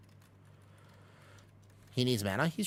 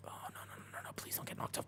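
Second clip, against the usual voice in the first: tense whisper; 15 dB quieter than usual. Real, not acted. About to be knocked off, panic.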